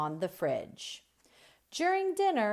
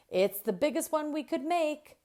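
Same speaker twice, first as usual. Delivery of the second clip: excited shout; 10 semitones higher, faster than usual. Acted. Owen and Haley presenting their huge fan, high excited shout. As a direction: show off the giant fan proudly. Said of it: "they shout".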